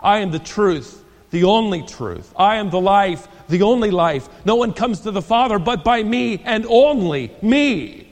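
each line building in weight and pitch; proclaimed scripture. Shown as a demonstration. Preacher declaring Jesus' words to the congregation, building emphasis. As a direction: read it aloud like a proclamation. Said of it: "You could read it".

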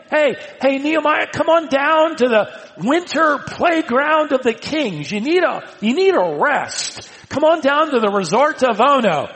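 hammed-up tempting call to come down; high coaxing lure. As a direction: lure him down like a smooth tempter. Enemies lure Nehemiah.

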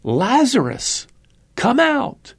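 calling out, pitch soaring on come out; Jesus calling a dead man out of the tomb, ringing call; crying out. Acted. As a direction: summon him from the tomb with a great cry. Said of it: "cries out".

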